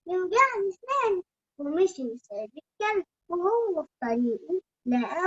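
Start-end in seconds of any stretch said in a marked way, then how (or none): 0.1-1.2 put-on high, fast, whiny child voice; little bunny voice. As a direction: squeak it like a hungry bunny. Bunny refuses bones.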